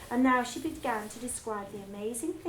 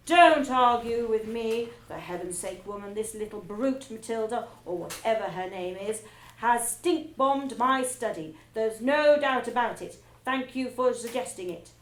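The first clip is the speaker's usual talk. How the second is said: bullying rant; 6 dB louder than usual. Acted. Trunchbull bullies Miss Honey.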